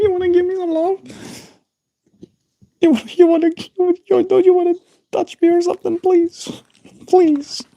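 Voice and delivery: very high pleading puppy voice; dog's voice. Retold or imitated. Voicing the dog begging her for a scratch, high and whiny. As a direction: whine for pets like a needy dog. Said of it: "This dog, man, like".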